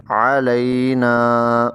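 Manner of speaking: علینا very slowly, the ع from the throat; careful slow recitation. Shown as a demonstration. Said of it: "عین کا بھی خیال رکھنا ہے". (حلق سے عین)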